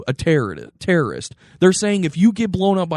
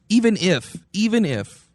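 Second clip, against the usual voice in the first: usual speaking voice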